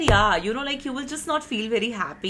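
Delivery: ordinary talk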